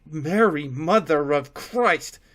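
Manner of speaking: loud shocked exclamation; taken aback. A narrator's reading. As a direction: react shocked at the sight. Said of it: "a taken aback".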